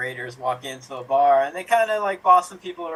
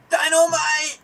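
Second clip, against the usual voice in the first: Dynamite catchphrase shout; 13 semitones higher, slower than usual. Retold or imitated. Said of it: "and they go".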